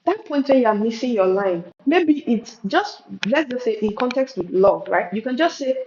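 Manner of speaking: ordinary talk, nothing marked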